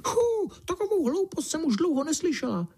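very high, thin little mouse voice; high-pitched mouse exclaiming; squeaky field mouse cry. A narrator's reading. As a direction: cry out as a tiny indignant mouse. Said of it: "vykřikla rezavá polní myška".

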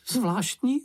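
high, squeaky, sceptical little creature; doubting Sniff. A narrator's reading. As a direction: say it high and disbelieving. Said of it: "ozval se čenich". (vysoký pochybovačný hlásek)

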